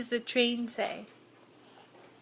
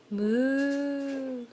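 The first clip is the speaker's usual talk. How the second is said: cow moo. Shown as a demonstration. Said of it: "What does the cow say?".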